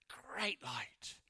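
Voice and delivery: breathy hushed whisper. Real, not acted. Preacher whispers the Christmas verse to the congregation in awe. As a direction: let the words fall to a reverent whisper.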